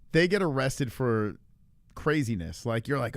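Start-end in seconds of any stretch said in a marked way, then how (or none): none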